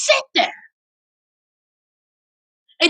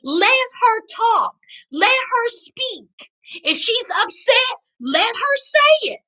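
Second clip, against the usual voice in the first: outraged commenters; 5 semitones higher than usual. Retold or imitated. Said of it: "people in the comments while they were going live was like".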